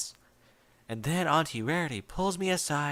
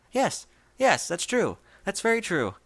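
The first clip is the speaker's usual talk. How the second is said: much higher and louder, eager to please; frantic agreement; 6 semitones higher, 5 dB louder than usual. A narrator's reading. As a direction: agree far too fast and too bright.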